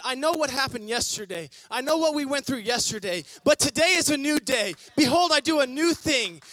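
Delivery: loud, high, half-sung proclamation; triumphant declaration. Acted. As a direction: shout it like a victory cry. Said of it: "They said".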